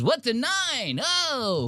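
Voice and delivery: very high, frantic mock voice with a laugh; panicked Mark. Teasing Mark's terror at a nicotine pouch, squealing high. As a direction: squeal it like he thinks nine means a gun. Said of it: "He goes".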